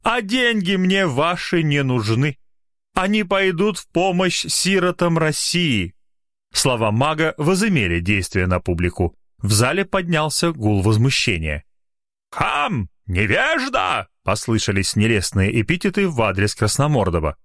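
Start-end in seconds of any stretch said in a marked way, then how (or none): 0.0-5.9 pompous magician. Acted. Magician continues. 12.3-14.1 indignant crowd. Acted. Crowd insults heckler.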